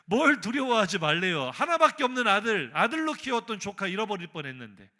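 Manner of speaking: loud, high burst of protest; indignant retort. Acted. Preacher protesting as Abraham after nearly losing his nephew, indignant. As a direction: throw the words back in hurt disbelief.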